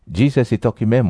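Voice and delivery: ordinary talk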